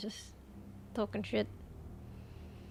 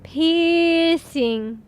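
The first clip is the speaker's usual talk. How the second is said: "Piercing" stretched slowly, a bit higher and louder; 4 semitones higher, 5 dB louder than usual. Shown as a demonstration. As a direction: draw out "Piercing" in slow motion. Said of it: "I wanna talk in slow-mo".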